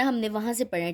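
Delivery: usual speaking voice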